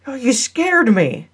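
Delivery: high-pitched startled cry, stretched at the end; startled scream. A narrator's reading. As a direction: cry out as if someone just jumped you. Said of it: "Caroline screamed".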